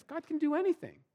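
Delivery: mock-bright assurance that rings hollow; hollow, overblown confidence. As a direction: say it like a cheerful slogan you don't believe. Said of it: "in my head, I would say".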